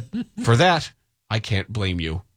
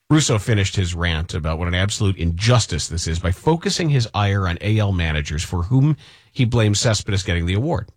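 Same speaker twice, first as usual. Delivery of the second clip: ordinary talk, nothing marked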